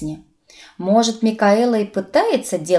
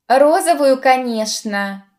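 high start, slow knowing finish; smirking; 5 semitones higher, 4 dB louder, slower than usual. A narrator's reading. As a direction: answer teasingly, stretching it out. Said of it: "усмехнулся Нагас". (с усмешкой, нараспев)

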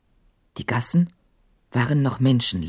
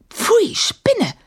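indignant hiss; 3 dB louder than usual. A narrator's reading. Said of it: "zischte sie empört".

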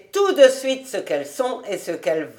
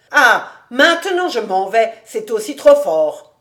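raised, louder protest; indignant outburst; 4 semitones higher, 6 dB louder than usual. Acted. Old Rébé threatens to leave Cobus's table, indignant. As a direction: huff that this is too much.

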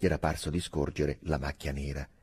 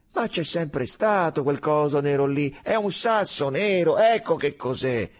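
high, raised voice, stretching the words; loud insisting; 11 semitones higher, 8 dB louder than usual. A narrator's reading. Soldier tells comrades it's a rock, insisting loudly.

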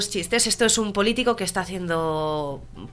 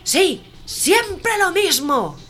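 weary heckler; 11 semitones higher, 5 dB louder, much slower than usual. Acted. Heckler repeats.

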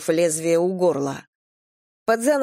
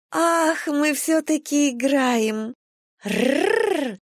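put-on high, slow teasing girl, then growl; playful growl; 8 semitones higher, much slower than usual. A narrator's reading. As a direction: tease and growl like a kitten. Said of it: "задорно прорычала она". (задорное игривое рычание)

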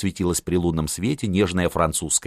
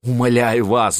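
higher-pitched, mildly weepy entreaty; tearful pleading; 5 semitones higher, 4 dB louder than usual. A narrator's reading. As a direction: beg with a touch of tears. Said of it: "заплакал сын". (слегка плаксивая мольба)

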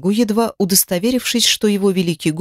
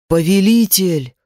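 rumbling demon. A narrator's reading. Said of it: "пророкотал Кайтев". (рокочущий голос демона)

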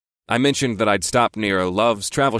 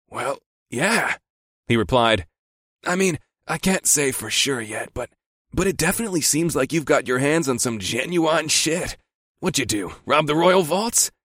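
higher casual friend voice; lightly voiced buddy; 5 semitones higher than usual.

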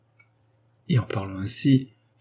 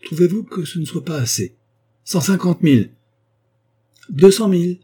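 each offer pitched higher and louder, ending near a shout; escalating bids; 9 semitones higher, 6 dB louder than usual. Acted. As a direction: push the price up until she's almost shouting. Flavia monte ses offres. (Flavia surenchérit de plus en plus fort, presque en criant)

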